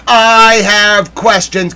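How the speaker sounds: drawn-out, loud, indignant declaration; slow, forceful demand for answers. Real, not acted. Livestreamer demanding answers about the burning Capitol, indignant.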